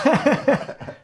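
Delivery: loud high laugh; big real laugh; burst of laughter. Real, not acted. Laughs at own story.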